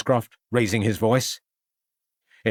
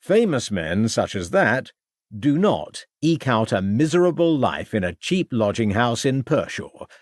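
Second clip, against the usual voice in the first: usual speaking voice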